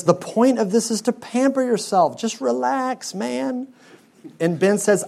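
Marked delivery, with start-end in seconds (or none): 0.0-3.7 laid-back Tom. Retold or imitated. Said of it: "And Tom says".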